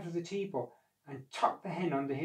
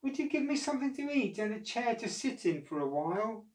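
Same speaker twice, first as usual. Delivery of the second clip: put-on higher, pleading boy's voice; tired boy; 9 semitones higher than usual. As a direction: ask meekly for food. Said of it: "said Jack".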